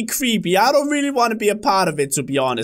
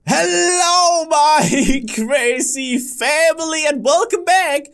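YouTuber intro; 6 semitones higher, slower than usual. Acted. Channel intro greeting.

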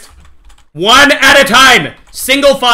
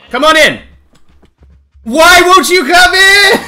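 exasperated plea; 8 semitones higher, 4 dB louder than usual. Real, not acted. Streamer pleading with stuck farm animals, exasperated whine. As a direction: stretch out the frustration. Animals still won't enter.

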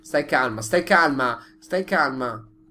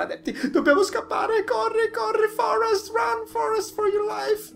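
mock panic shout. Fleeing in game, Forrest quote.

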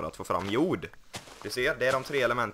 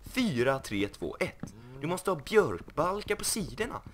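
mock TV carpenter scolding the builder; Arga snickaren; 9 semitones higher than usual. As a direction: boss the house around like a grumpy carpenter. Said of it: "Vi får ringa arga snickaren snart".

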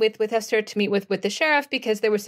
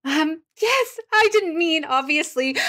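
higher, embarrassed hurry to take it back; flustered backpedalling; 6 semitones higher, slower than usual. Acted. Jenna to the offended lady after the grandmother remark, flustered.

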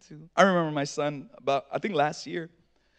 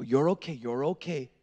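low, soft retold comfort, sinking; understated fatherly calming; imitating reassurance; 5 semitones lower, 4 dB quieter than usual. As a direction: soothe a frightened son quietly. Reassures hurt son in story.

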